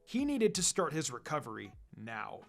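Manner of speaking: ordinary delivery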